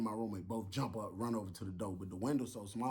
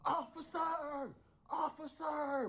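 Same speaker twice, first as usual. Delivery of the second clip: screaming officer; much slower than usual. Retold or imitated.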